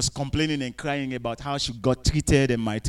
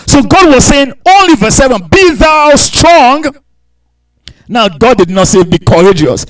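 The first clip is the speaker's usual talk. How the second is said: loud, driving sermon, pitch raised high; preaching at full force; 5 semitones higher, 13 dB louder than usual. Acted. Pastor exhorting the congregation, preaching at full force.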